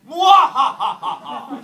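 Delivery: slow, loud, high evil-scientist cackle; maniacal laugh. Shown as a demonstration. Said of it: "I need everyone to laugh like a scientist".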